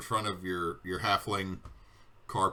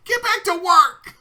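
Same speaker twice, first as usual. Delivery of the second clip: Kiwi shouting; 13 dB louder, slower than usual. Acted.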